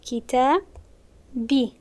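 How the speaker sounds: کتابی drawn out very slowly, syllable by syllable; slow, deliberate model. Shown as a demonstration. Teacher slowly spelling out کتابی for students. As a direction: let the class hear کتابی slowly. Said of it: "teacher repeats form slowly".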